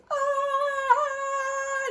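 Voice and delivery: sudden high yelp; startled yelp. Acted. Gerald startled by the bird, high yelp. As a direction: yelp in fright.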